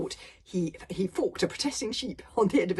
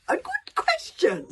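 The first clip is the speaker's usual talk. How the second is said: slow, high, surprised purr; a monster pleasantly startled by a clever question; surprised dragon; 8 dB louder, slower than usual. A narrator's reading. Dragon pleasantly surprised by the boy's question. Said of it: "said the dragon in surprise".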